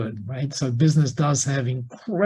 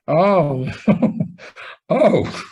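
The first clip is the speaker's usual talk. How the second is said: dawning realisation; 4 semitones higher than usual. Retold or imitated. Reenacts the moment it dawned.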